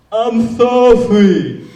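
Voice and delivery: very loud, high, drawn-out mock deaf speech; imitated deaf kid. Pretends to the yelling woman that he is deaf, loud imitated deaf voice. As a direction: put on a deaf kid's voice, slow and loud. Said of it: "think she yelled at a deaf kid".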